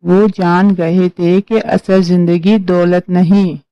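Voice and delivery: ordinary delivery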